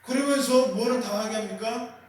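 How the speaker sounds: ordinary talk, nothing marked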